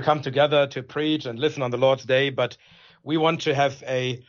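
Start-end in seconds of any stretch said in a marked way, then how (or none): none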